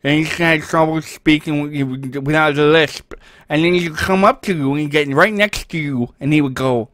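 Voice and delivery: lightly voiced kid with a lisp. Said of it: "he's always speaking like this".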